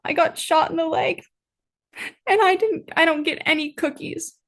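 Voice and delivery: high, halting mock sob; Hotch sobbing. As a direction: stumble through it in a high, hammed-up weepy voice. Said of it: "Hotch sobs".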